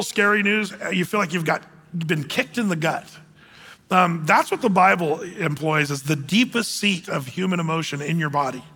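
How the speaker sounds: ordinary delivery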